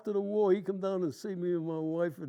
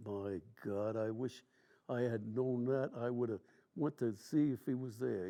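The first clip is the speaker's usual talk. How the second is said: much lower, softer rueful words; quiet, heavy regret; regretful; 7 semitones lower, 5 dB quieter than usual. Retold or imitated. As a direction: sigh it out with regret. Regretful remembered thought.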